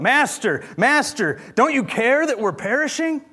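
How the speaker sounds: high, pleading cry of the disciples; panicked cry. Acted. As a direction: call out as if the boat is sinking.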